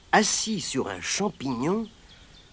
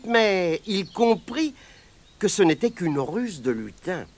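ordinary delivery, nothing marked

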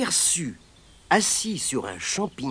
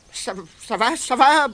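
high stammer, rising louder; stammering leprechaun; 8 semitones higher, 5 dB louder than usual. Acted. Terrified leprechaun gives in to Pat, high and stammering. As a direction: stutter out a panicked surrender. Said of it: "bredouilla-t-il".

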